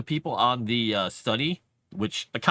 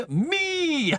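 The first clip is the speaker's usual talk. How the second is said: laughing; 11 semitones higher than usual. Real, not acted. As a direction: burst out laughing as you own up. Laughing admission.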